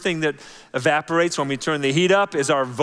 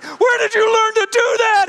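shocked dad; 6 dB louder than usual. Retold or imitated. Dad freaking out at cards.

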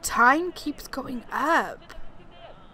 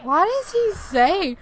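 high, slow, puzzled; baffled exclamation; 7 semitones higher, 6 dB louder than usual. Real, not acted. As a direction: ask it in disbelief. Confused by the game.

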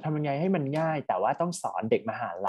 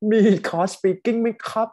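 higher, louder mock student question; imitating a student's inquiry; 8 semitones higher, 6 dB louder, slower than usual. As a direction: voice the messaging student, pitched up and drawn out. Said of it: "คือเขา message มาตอบ". (เลียนเสียงนักเรียนถามหาคอร์ส เสียงสูง ลากช้า)

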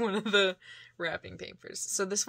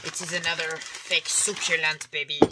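put-on playful French accent on the line; a light French-accented baby at the end. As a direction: slip into a French accent, teasing. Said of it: "I don't know why I just went French".

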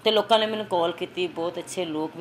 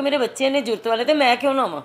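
higher and louder, rising to a cry of resolve; fierce resolve; 6 semitones higher, 7 dB louder than usual. Retold or imitated. Mother to herself about her children, a loud defiant cry. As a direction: hurl it out like a vow to fight on.

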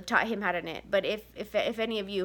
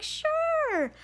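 high, sing-song fake-cheery mom voice; chirpy forced yes to the kids; fake cheerful; 9 semitones higher, slower than usual. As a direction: put on a bright smile over your exhaustion. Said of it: "And I'm like".

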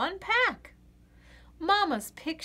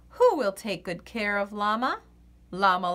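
plain reading voice

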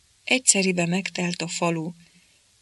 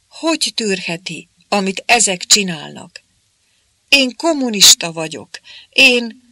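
outraged protest; 3 semitones higher, 6 dB louder than usual. A narrator's reading. Smith protests to the commander.

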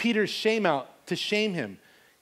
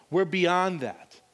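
slow, deliberate words; slowed-down sermon emphasis; slow emphatic preaching. Acted. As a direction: lean on each word to land it.

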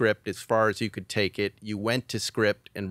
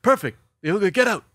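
much higher, louder hammed-up producers; brusque dismissal; 9 semitones higher, 6 dB louder than usual. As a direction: shoo him out like busy bosses. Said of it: "They, they go".

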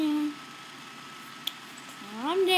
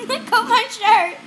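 laughing; 6 semitones higher, 7 dB louder than usual. Real, not acted. Dog tugging his shirt.

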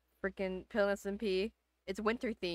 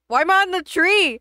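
startled shout; 9 semitones higher, 13 dB louder than usual. Real, not acted.

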